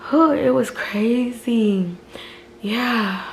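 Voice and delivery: normal talking voice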